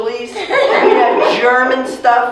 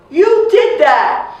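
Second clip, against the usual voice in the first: very high, loud, slow accusing yell; accusing shout; 10 semitones higher, 6 dB louder, much slower than usual. Retold or imitated. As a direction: hurl the blame at the father, slowly. Child accusing father of mother's death.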